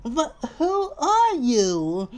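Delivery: high, slow, drawn-out question; fussy indignation. Acted. Mister Fussy questions the stranger, high indignant voice. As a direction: demand it in a prissy high voice.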